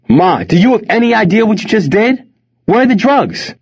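high-pitched furious yelling; senseless with anger. A narrator's reading. Son bursting in on his mother, senseless with anger. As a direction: demand answers in a rage. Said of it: "Wes was senseless with anger".

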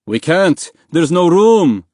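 high, loud refusal; yelling. A narrator's reading. Man in the dinghy refusing the swimmers, urgent yell. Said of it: "a man inside the dinghy yelled".

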